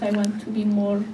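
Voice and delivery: usual speaking voice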